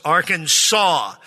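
"Arkansas" said AR-kan-saw, slowly; three slow syllables in "Arkansas", ending in "saw"; correct state pronunciation. Shown as a demonstration. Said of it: "accent on the first and last syllables".

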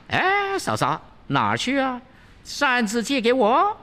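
high, cocky monkey calling after her; high cheeky monkey demanding the fan; teasing Wukong. Acted. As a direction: block her way, grinning.

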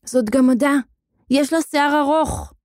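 put-on high, amazed child's exclamation; excited child. A narrator's reading. Children shouting over the caught witch. (קריאה נרגשת של ילד)

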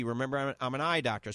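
usual speaking voice

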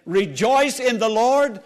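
loud, rising preacher's exhortation; rally the congregation, punching the key words; full-force preaching. Real, not acted. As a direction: stretch out the big word like a rallying cry.

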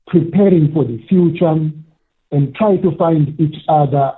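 ordinary delivery

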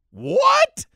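very high shouted what; surprised shriek. Real, not acted. Exclaimed reaction.

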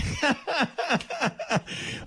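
real belly laugh. Real, not acted. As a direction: burst out in a high cackle. Big laugh at the joke.

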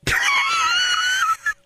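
bursting into a high laughing shriek; helpless cackle of real amusement; big real laugh. Real, not acted. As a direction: let a huge laugh burst out. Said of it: "That tickles me".

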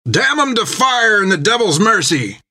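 high defiant curse; high raging sailor's curse; angry cry. A narrator's reading. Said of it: "cried others".

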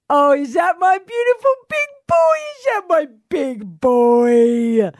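loud, high, slow gushing to a dog; sing-song doggy baby talk. Retold or imitated. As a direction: coo excitedly at your big dog, stretching the words. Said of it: "I have a voice that I talk to Wolfie".

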